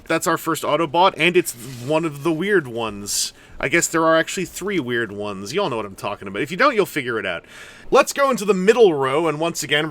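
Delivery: ordinary talk, nothing marked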